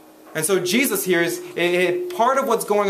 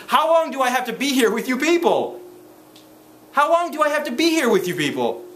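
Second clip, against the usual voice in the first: pitched up with frustration; exasperated cry; 6 semitones higher than usual. Acted. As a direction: let the frustration break through. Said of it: "you can hear it in Jesus' voice".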